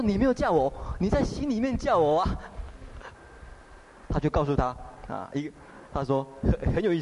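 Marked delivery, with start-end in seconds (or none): 0.0-2.3 higher, lightly voiced young wife; a hint of the young wife's voice; wife's reply. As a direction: answer sweetly as the wife. Wife's voice in the story.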